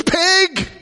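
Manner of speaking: very high, sharp shouted insult from the other car; woman yelling Pig. Retold or imitated. Woman in the other car yelling at him, high mock shout. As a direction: yell it at the swerving driver. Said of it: "she looks at him and goes".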